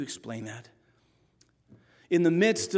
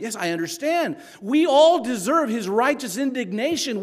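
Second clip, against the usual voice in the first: usual speaking voice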